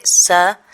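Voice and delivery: sa with a buzzing z sound; voiced S. Shown as a demonstration. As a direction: demonstrate sa with a voiced Catalan s. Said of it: "es una S sonora".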